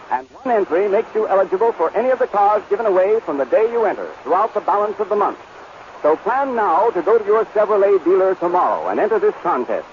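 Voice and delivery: ordinary talk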